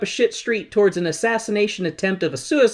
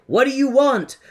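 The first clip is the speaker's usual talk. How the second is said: demanding Oz head; 8 semitones higher than usual. Retold or imitated. Oz head demanding what they want, high and pushy. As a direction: bark it like a pushy giant head. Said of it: "as it's like".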